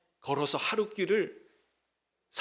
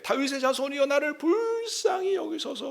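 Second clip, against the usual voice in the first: much higher pleading cry; crying out louder; 9 semitones higher than usual. Retold or imitated. Blind beggar crying out over the crowd, high desperate plea. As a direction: cry out for mercy even harder, high. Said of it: "더욱 크게 소리 질러 이르되".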